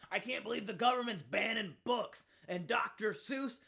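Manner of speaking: high mock outrage. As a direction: parody a clueless pundit. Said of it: "right-wing airheads have basically just been like".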